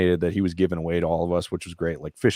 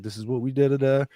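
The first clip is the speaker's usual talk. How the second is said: lightly voiced gentle lady; 6 semitones higher than usual. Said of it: "She's like".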